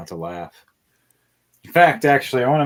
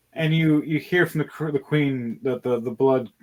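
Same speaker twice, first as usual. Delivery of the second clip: ordinary delivery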